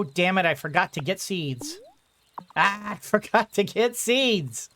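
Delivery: ordinary talk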